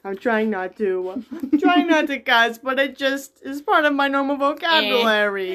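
giggling through an apology; laughing while talking. Real, not acted. Laughing apology after cussing.

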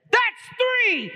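high, loud, explosive count; angry scream. Acted. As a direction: scream the final count as the fed-up groom. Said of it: "He screams and he says".

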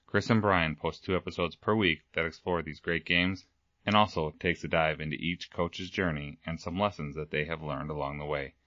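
brisk promo read. Acted. Host plugging a partner podcast, brisk promo read. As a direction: pitch the other show to listeners.

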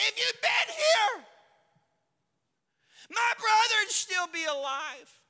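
high, loud pained lament; Mary wailing her grief at Jesus; anguished cry. Acted. Mary's anguished reproach.

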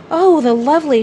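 a rush of wonder, pitch lifted; delighted cry. A narrator's reading. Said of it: "she cried".

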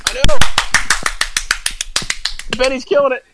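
high, loud excited yell; excited cheer. Real, not acted. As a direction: cheer the hit. Cheering a big hit.

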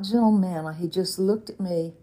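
usual speaking voice

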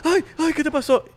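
high, loud, drawn-out mock fussing; hammed-up squeal of parental alarm; panicking parent. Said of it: "y los papás".

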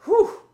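high loud puff of breath; winded whew. Real, not acted. Podcaster blown from running to the kitchen, whew. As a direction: blow out air after the sprint. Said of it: "Blown up from just running to the kitchen".

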